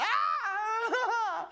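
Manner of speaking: high laughing shriek of panic; mock panicked scream. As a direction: squeal it high through a laugh. They're freaking out.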